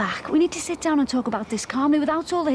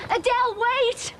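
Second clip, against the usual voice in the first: high, drawn-out call, slow; desperate call after her fleeing daughter; 9 semitones higher, slower than usual. Acted. Mother calling after her daughter.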